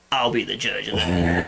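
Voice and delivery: low, slow tough action-movie line; horror trailer voice. Retold or imitated. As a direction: deliver it like a gritty trailer hero. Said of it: "and she goes".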